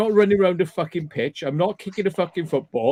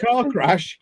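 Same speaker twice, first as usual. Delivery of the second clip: ordinary talk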